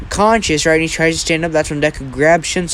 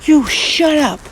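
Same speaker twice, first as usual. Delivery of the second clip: high, angry outburst; acted furious retort; super pissed; 12 semitones higher, 4 dB louder, slower than usual. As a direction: snap it back in a pitched-up voice. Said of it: "Shinso is just getting super pissed".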